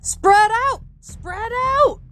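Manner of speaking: high, urgent call to a crowd; high drawn-out shout; crying out. A narrator's reading. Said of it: "cried someone".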